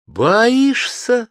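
high, loud startled cry; exclaiming in shock. A narrator's reading. Joy exclaims at her fiancé's fear, shocked. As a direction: blurt it out in disbelief. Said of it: "воскликнула Джой".

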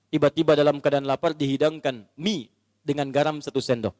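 ordinary talk

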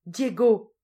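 loud, high call of sudden dread; alarmed cry. A narrator's reading. Old woman seized by dread as he leaves, alarmed cry. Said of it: "lui cria la duègne, saisie d'une subite épouvante".

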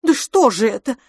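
high, loud exasperated plea; desperate plea. A narrator's reading. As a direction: beg for sense at the end of your wits. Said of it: "взмолилась несчастная мисс Петтигрю".

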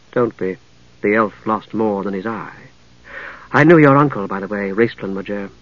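low, measured cultured woman, reminiscing; composed lady knight. Acted. Lady knight recalling the mage's uncle, composed and unhurried. Narrator voices Lady Catherine.

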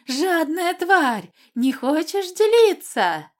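high, slow shrieking monster voice; eerie shriek. Acted. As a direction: screech it like a hungry water spirit. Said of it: "заверещала жутким голосом".

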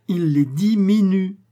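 "Il les diminue" slow, high and loud, punching "diminue"; stressed word. Acted. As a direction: hammer home "diminue". Porter stresses the incredible word.

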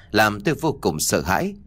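usual speaking voice

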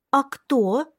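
put-on high child voice asking why; curious little girl. A narrator's reading. Little girl keeps asking her brother why. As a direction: wonder aloud like a small child.

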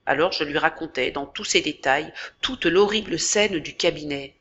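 ordinary delivery, nothing marked

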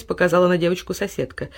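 usual speaking voice

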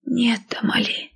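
weak, breathy near-whisper; soft whispered protest of a sick girl; quiet croak. A narrator's reading. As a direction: plead feebly from a sickbed, barely voiced. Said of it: "тихо прохрипела с кровати Эмбер".

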